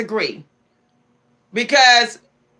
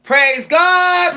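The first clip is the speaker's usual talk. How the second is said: high, loud whoop of praise; mock church hallelujah, loud and slow; joyful shout; 6 semitones higher, 6 dB louder, much slower than usual. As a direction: shout it out like you're set free. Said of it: "You're like".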